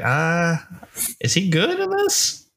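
high-pitched, doubtful question; retold apprehension. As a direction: replay your first doubts, pitch climbing high. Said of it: "apprehensive at first".

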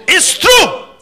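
short, high, loud insistence; emphatic shout. Real, not acted. Preacher insisting his teaching is real, emphatic shout. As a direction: punch it out at full voice.

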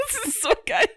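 high laughing delight. Real, not acted. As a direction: squeal it through laughter. Laughing at the lyrics.

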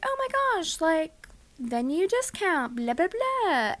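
high sing-song gush; bubbly email voice. Retold or imitated. Own cheery email voice retold.

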